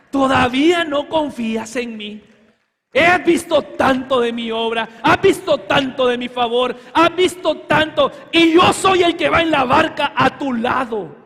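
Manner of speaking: loud, pleading rebuke; the Lord asking the congregation to trust him, loud. Acted. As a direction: ask it as the Lord reminding a doubter. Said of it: "a ti te está diciendo el Señor hoy".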